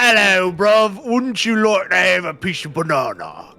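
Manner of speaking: cheeky Cockney impression, played for laughs; mock Cockney. Teasing a friend about her Cockney, hammed-up Cockney voice. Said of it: "Pennyworth is, like, Cockney".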